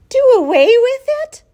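horrified high, loud shriek. A narrator's reading. Daughter to mother on hearing the runt's fate, shrieked. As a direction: shriek it in disbelief. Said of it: "shrieked Fern".

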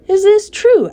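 high and loud, doubtful rat; the rat doubts Wilbur's news, high and loud; suspicious surprise. A narrator's reading. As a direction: shoot up in disbelief. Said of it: "eyeing the sac suspiciously".

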